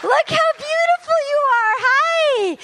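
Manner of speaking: high, slow gasping delight; overwhelmed surprise. Acted. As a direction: gush at the crowd, thrilled. Host greets crowd, overwhelmed.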